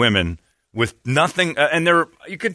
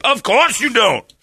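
Donnell Rawlings; 11 semitones higher, slower than usual. Retold or imitated. Comedian baiting a host, high accusing shout. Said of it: "he goes".

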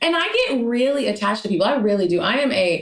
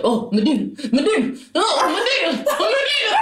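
put-on very old man; 11 semitones higher than usual. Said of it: "he had this old man voice".

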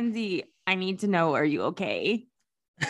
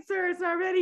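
laughing; 6 semitones higher, faster than usual. Retold or imitated. Acted Wendy's worker quote.

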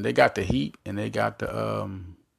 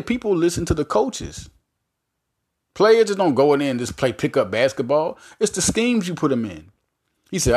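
usual speaking voice

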